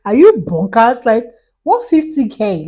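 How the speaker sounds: loud, high, incredulous outburst; outraged disbelief. Retold or imitated. Telling off the scheming middleman, loud and high in disbelief. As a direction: shout it like you can't believe the nerve. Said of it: "I'm like".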